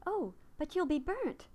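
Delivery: alarmed cry. A narrator's reading. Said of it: "she cried".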